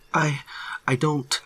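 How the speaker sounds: high, faltering protest; frightened stammer. A narrator's reading. Hiker unable to answer ranger, high frightened stammer. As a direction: break off helplessly. Terrified man cannot answer.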